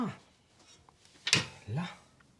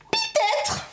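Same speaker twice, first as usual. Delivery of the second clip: high mocking squeal; 3 dB louder than usual. Real, not acted. Mocking echo of own reply.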